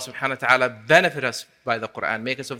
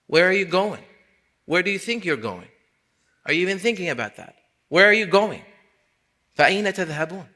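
high, pointed sermon questions; impassioned preaching; 7 semitones higher, 5 dB louder, faster than usual. Acted. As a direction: challenge the congregation, voice raised.

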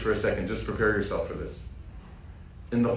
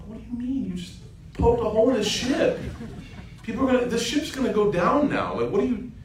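a lightly raised, incredulous voice; a mild, indignant protest; high, protesting Musa; 12 semitones higher than usual. Acted. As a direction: object in a high, bewildered tone.

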